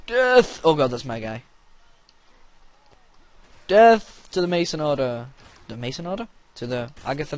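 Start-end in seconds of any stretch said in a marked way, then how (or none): 0.1-0.6 shouted high and loud; battle cry. Pretend. 3.7-5.3 loud, high war cry; battle cry. Pretend.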